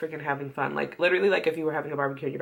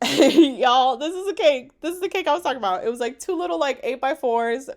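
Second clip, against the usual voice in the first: excited; 7 semitones higher, 8 dB louder than usual. Real, not acted. Excited showing her wedding cake.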